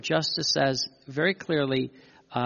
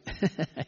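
soft laugh; 5 dB quieter than usual. Real, not acted. Laughs at Jesus' humor.

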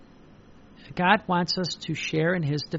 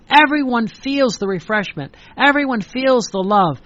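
louder, higher proclamation; fervent preaching; 4 semitones higher, 6 dB louder than usual. Acted. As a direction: lift the crowd with each line. Preaching crescendo.

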